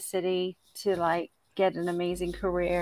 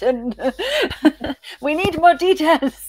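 laughing eagerness; 5 semitones higher, 9 dB louder than usual. Real, not acted.

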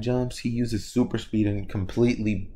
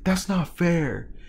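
high, drawn-out complaint; whiny protest; 7 semitones higher, much slower than usual. Acted. Whole class objecting to expulsion rule, whiny protest. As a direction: stretch it out slowly in a high protest.

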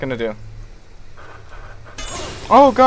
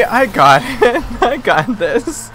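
slow giggling I got this; laughing through the words while swerving; chuckling fake confidence; 5 semitones higher, slower than usual. Real, not acted.

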